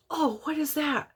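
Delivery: a lightly voiced curious toddler; curious little boy. Mother imitating her little son finding the gift, lightly childlike. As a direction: perk up like a small kid. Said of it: "Little man immediately goes".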